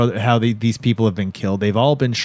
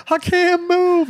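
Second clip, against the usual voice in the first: very high panicked cry; mock panic; slower than usual. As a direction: squeal it like a man frozen in terror. Acted quote, trapped man panicking.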